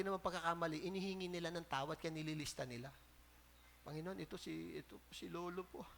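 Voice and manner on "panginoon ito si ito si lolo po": humble prayer. Retold or imitated. A prayer naming ancestors.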